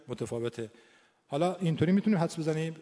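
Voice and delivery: normal talking voice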